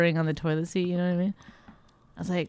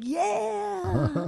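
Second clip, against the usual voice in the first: high, drawn-out chirpy yeah; eager yes; 14 semitones higher than usual. Retold or imitated. Wife imitates the emcee answering, high and chirpy. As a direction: beam out the yes. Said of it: "He's like".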